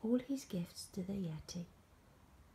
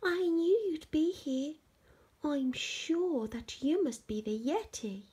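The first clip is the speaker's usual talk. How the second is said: put-on high, eager boy's voice; thrilled and sure, pitched well up; excited; 9 semitones higher, 5 dB louder than usual. George tells the monster it must be the Yeti, high and excited.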